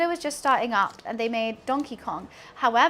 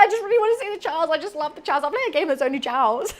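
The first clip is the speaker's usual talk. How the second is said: laughing retelling; 2 semitones higher, faster than usual. Retold or imitated. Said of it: "I was like".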